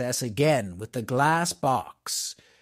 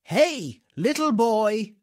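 higher call down from a high window; a prisoner calling to a boy in the street, raised voice; 5 semitones higher, slower than usual. A narrator's reading. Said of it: "called to him".

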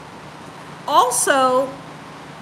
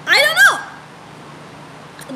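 high-pitched uncertainty; 10 semitones higher than usual. Real, not acted. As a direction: voice leaps up high. Answering viewer's tattoo question.

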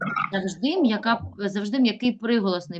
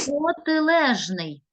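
slow, drawn-out model pronunciation; розтягнуто; 4 semitones higher, much slower than usual. Shown as a demonstration. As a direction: say it slowly and clearly for the class. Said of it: "Звучить воно так". (повільно й розтягнуто)

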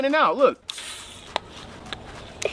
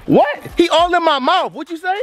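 mock-affronted disbelief; 8 semitones higher than usual. Real, not acted. Trash talk with child.

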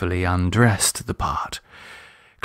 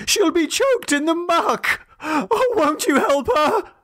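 very high, frantic begging; desperate pleading. A narrator's reading. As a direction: beg the children to save her. Father begs children for help.

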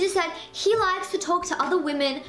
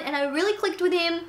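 normal talking voice

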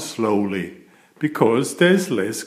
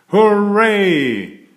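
high, loud joyful hooray; excited cheer; 8 semitones higher, 5 dB louder than usual. A narrator's reading. Narrator cheering as the giant throws the capsule, loud and high.

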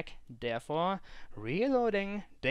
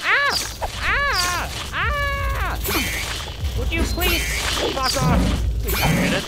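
much higher, much louder screams; panicked screaming; 10 semitones higher, 13 dB louder than usual. Real, not acted. Player losing the fight, high panicked screaming. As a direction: scream through the chaos.

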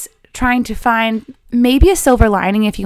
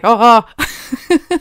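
mock nervous alarm; 5 dB louder than usual. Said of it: "nervous system kicking in and being like".

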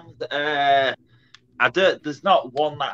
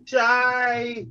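down a well; 7 semitones higher than usual. Real, not acted. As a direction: shout as if down a well. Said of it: "Sounds like you're falling down a well".

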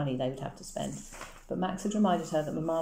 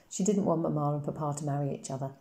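plain reading voice

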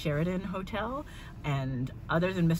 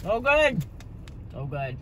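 muffled speech between chews; tags: chewing while talking; 2 semitones higher, 4 dB louder than usual. Real, not acted. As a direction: mouth partly full of food. Seen 0: Bit patty at -3; closed, working mouth while speaking after.

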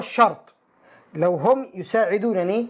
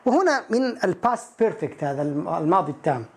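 ordinary delivery

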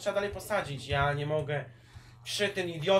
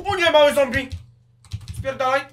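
panicked yell; 9 semitones higher, 14 dB louder than usual. Real, not acted. Baby zombie attacks.

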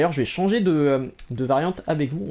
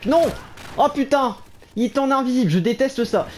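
startled outburst; 8 semitones higher than usual. Real, not acted. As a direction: burst out in frustrated shock. Invisible enemy appears.

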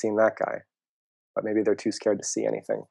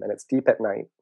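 ordinary delivery

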